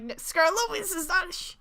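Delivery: put-on high, loud childish protest; little kid voice. Child correcting grandpa on tape, loud little kid voice. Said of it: "I just sound like this little kid".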